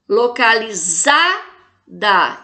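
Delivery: "Localizada" very slow, long strong "za", pause before "da"; stressed penultimate syllable. Shown as a demonstration. Teacher demonstrating paroxytones to class, "za" stressed in "Localizada". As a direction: stretch "za" in "Localizada", then drop in "da". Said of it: "é o za".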